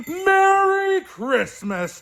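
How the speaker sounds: high, loud, slow festive cry; jolly Santa shout. Acted. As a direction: boom it out like Santa. Santa sign-off.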